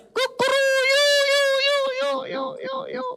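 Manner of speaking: very high crow echoing and fading away; squeaky rooster with a trailing echo. Acted. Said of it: "ayamnya bisa pakai songkat".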